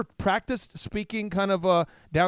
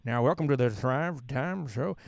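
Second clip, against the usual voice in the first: slow, low Oklahoma twang; Oklahoma drawl; 5 semitones lower, slower than usual. Retold or imitated. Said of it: "even four weeks ago".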